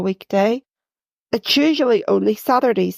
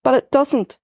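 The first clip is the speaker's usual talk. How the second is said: ordinary delivery, nothing marked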